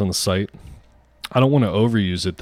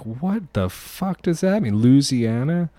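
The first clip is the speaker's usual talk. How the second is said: incredulous; 6 semitones higher, slower than usual. Retold or imitated. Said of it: "I'm like".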